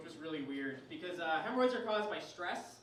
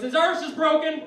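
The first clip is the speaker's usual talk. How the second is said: high, loud Irish announcement; angry Irish dad; 6 semitones higher, 12 dB louder than usual. Acted. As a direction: bellow it as an irate Irish father. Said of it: "my angry Irish father".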